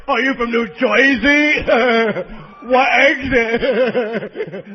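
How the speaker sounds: loud, drawn-out mock New Jersey accent. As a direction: mimic someone jeering in an exaggerated New Jersey accent. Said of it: "gotta make fun of the way we talk".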